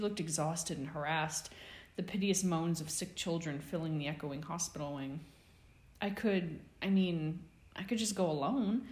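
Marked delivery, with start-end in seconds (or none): 6.0-8.9 hesitant, higher offer; tentative. A narrator's reading. Boy hesitantly offering the busy nurse to go alone. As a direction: offer it unsure, not wanting to burden. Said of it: "he asked, tentatively".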